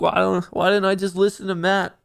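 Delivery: high, mocking whine of losing traders; teasing whiny voice; mock whiny bulls. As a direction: imitate crying bulls, lightly. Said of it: "They're like".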